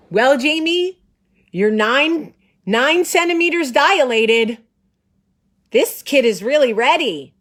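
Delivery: high, loud mock-doctor proclamation; doctor's big announcement. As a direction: announce it with exaggerated gusto. Acts out doctor's cheerful announcement.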